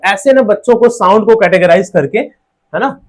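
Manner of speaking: ordinary talk, nothing marked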